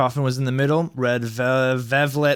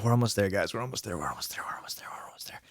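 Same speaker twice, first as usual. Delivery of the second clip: fading to a whisper; 4 semitones lower, 8 dB quieter, much faster than usual. Acted. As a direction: trail off softer and softer into a whisper. Reader fading out dramatically.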